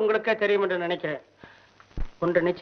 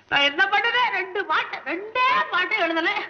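high whining lament; 8 semitones higher than usual. Acted.

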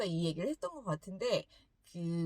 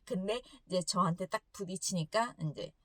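usual speaking voice

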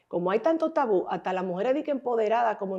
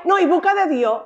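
high, loud mock "seek God"; hammed-up sanctimonious advice; preachy relative; 9 semitones higher, 9 dB louder than usual. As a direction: scold like a pushy churchgoer. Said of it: "Y dije".

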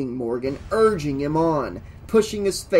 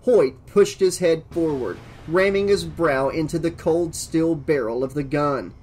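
usual speaking voice

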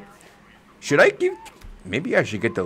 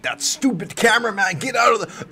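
high-pitched mock irritation; retold pissed yelling; 10 semitones higher than usual. Fan scolding a cameraman in the clip, high retold irritation. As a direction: replay your annoyance, pitched way up. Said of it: "I got pissed 'cause".

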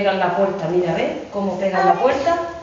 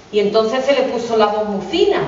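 ordinary delivery

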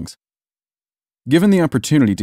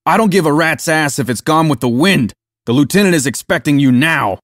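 higher-pitched, impatient sergeant's retort; irritated growl; 6 semitones higher, 4 dB louder than usual. A narrator's reading. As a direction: growl the order in annoyance. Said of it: "Pope growled as he walked away".

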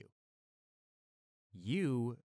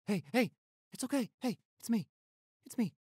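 urgent calling; 12 semitones higher, 6 dB louder than usual. Acted. Calling to scared girlfriend.

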